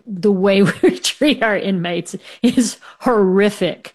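laughing through the words in disbelief; real laugh bubbling up mid-sentence. Real, not acted. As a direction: let helpless laughter break into it. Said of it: "I'm laughing".

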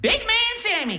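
very high Def Jam comic squeal; Cat Williams. Retold or imitated. Grandma on morphine greets grandson, high Def Jam-style exclamation. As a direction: imitate grandma hollering a hello in squeaky comic slang. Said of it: "she was talking in a crazy accent, like, uh, like Def Jam comedy, like Cat Williams".

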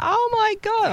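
high squeal, no extra volume; mock scream. Medium retells client's reaction to red car question, mock scream. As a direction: imitate her scream way up high. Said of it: "She screams out".

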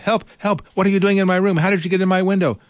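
high, fast, frightened cries for help; alarmed princess. A narrator's reading. Princess crying out at a girl in her window, high and panicked. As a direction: shriek for help at the intruder. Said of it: "Princess Beatrice screamed".

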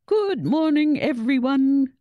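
loud, high headmaster on the PA; booming cheery greeting. A narrator's reading. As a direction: boom it across the whole school. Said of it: "boomed the headmaster's cheery voice".

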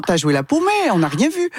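French players' phrase. Retold or imitated. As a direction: mimic the French players teasing her. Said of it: "c'est leur expression".

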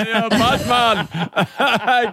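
slow, laughing echo. Real, not acted. Radio host laughing at the prankster caller. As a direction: laugh through the words.

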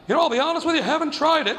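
high-pitched mock nervous reply; scared kid trying to be polite, hammed up; nervous honesty. Scared kid answers the inmate.

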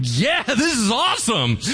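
excited shout. Acted. Lepers find abandoned enemy camp.

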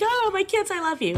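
high, choked-up pretend sob of thanks; mock teary cry. Teacher imagining her Juno speech, mock crying. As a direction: act out tearing up over the kids. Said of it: "I know I'm gonna cry".

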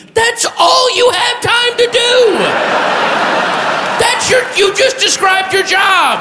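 very high, incredulous scream; outraged yell. Acted. Driver realizing three blocks later, high outraged yelling. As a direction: shriek the belated comeback. Belated retort to parking officer.

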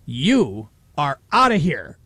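slow, weighty retold eviction order; fuming. Retelling the fair official throwing out the radio hosts, fuming. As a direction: lay down each word as a furious official. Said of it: "he was just fuming".

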